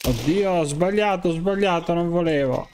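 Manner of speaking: high, rushed panicked apology. Real, not acted. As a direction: blurt it out in sudden panic. Panicking after a mistake in-game. (scuse in preda al panico, voce alta)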